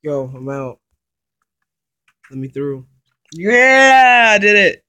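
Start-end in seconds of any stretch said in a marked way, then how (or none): 3.3-4.8 loud high victory shout; triumphant yell. Real, not acted. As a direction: shout it out, you won. Escapes the trap.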